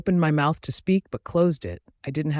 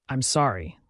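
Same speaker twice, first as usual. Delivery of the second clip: usual speaking voice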